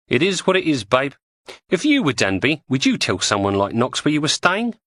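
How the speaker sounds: lightly voiced cockney woman; Julie's voice. Julie reasons with them.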